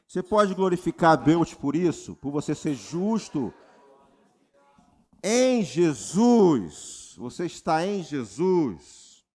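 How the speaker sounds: loud, slow, stretched preaching climax; full-force preaching. Acted. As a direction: proclaim it to the whole church. (pregação em alta voz, lenta e enfática)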